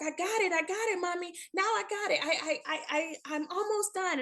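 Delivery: put-on high, hurried little-boy voice, stumbling over I; playing her six-year-old protesting he's got it; imitating her young son. Son to his mother, insisting he can bathe himself, in a high child voice.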